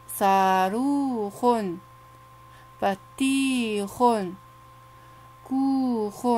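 put-on each word drawn out slowly for children to repeat; slow, clear teacher demo; slow model reading. Shown as a demonstration. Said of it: "هيا اقرأوا معي".

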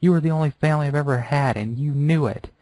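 high, crying plea to her idol; tearful pleading. Acted. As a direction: beg through tears. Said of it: "Tears were pouring down her face".

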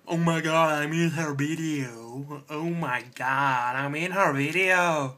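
slow, drawn-out fan gushing; put-on voice of an overexcited fan; mock thrilled fan. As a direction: stretch it out in hammed-up awe. Acting a thrilled fan reaction.

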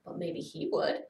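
usual speaking voice

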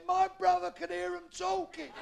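high, slow holler; drawn-out long-distance shout; lookout's shout. Acted. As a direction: call the answer down from aloft.

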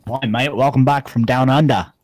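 put-on loud, hearty Australian accent. Friend answers accent request, exaggerated Australian accent. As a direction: put on a big Aussie accent. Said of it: "Should I do an Australian accent?".

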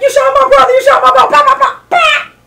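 high, loud tearful shouting; mock crying. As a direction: wail it like a comic movie impression. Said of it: "And he was crying".

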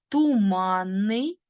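«Туманний» slow, the НН held long; long doubled N. Shown as a demonstration. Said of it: "Корінь туман, суфікс ен". («Туманний» повільно, з подовженим «нн»)

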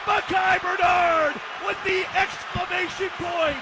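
high, drawn-out shouts; exultant touchdown call. Real, not acted. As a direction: ring out the final score in triumph.

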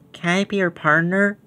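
a new kindergartner timidly asking to be partners; timid child asking Ruthie to be her partner, softly; shy little girl. Acted.

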